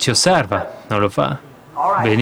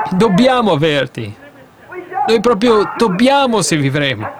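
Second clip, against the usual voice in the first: earnest prayer; 5 semitones higher than usual. Acted. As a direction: beg God for the dying people. Voices Amram's earnest prayer.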